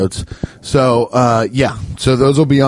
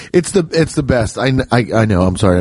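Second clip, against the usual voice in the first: ordinary delivery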